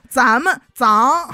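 脏 said slowly as zang for 咱们; Fengning dialect pronunciation. Shown as a demonstration. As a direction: drag out 咱们脏 with zang. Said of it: "叫成拧".

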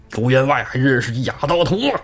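put-on voice of a taunting bandit; retold cocky call-out; jeering challenge. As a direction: jeer at an old acquaintance, mock-cocky. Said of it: "跟卢俊义的孩儿叫嚣".

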